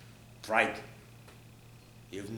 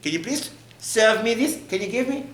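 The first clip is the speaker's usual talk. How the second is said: high, loud mock request; demanding husband; 9 semitones higher, 4 dB louder than usual. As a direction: imitate a husband wanting to be waited on. Mocking a husband demanding service.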